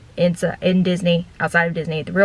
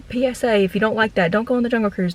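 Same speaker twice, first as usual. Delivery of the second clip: normal talking voice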